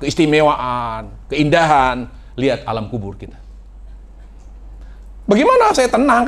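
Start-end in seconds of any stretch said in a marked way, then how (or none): 5.3-6.3 high, worried plea; anxious Utsman. Retold or imitated. Preacher voicing Utsman fearing his fate in the grave, high anxious voice. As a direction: ask it high with fear of death.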